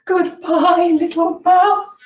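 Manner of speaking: high, loud mock-frightened goodbye; storybook alarm. A narrator's reading. Owl flees from the Gruffalo, loud high acted alarm.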